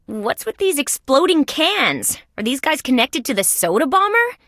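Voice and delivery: high-pitched, incredulous questioning; puzzled disbelief. Acted. Detective questioning the exploding cans, puzzled and high-pitched. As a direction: ask it high, baffled by what turned up.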